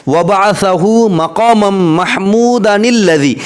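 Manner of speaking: drawn-out, very slow chanting; slow measured Quranic-style reading; very slow recitation. Shown as a demonstration. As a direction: stretch each syllable like a slow recital. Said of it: "मिलाक पढ़िएगा".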